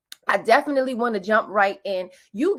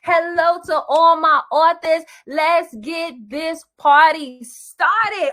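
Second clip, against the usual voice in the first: loud, high, stretched hype call; hype host; 7 semitones higher, 6 dB louder, slower than usual. Real, not acted. Host firing up her authors at the start of the webinar. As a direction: whip the crowd up.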